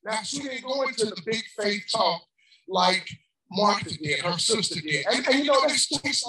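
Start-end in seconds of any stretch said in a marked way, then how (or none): none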